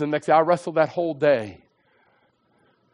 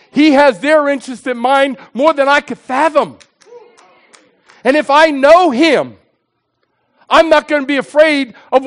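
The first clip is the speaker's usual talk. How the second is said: raised, ringing sermon delivery; impassioned preaching; 8 semitones higher, 9 dB louder than usual. Real, not acted. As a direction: drive each phrase home to the congregation, high and loud. Preaches at full force.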